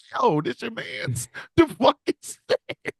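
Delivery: high, slow, drawn-out disbelief; incredulous to himself. Retold or imitated. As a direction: stretch it out in squeaky mock disbelief. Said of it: "saying that out loud to myself".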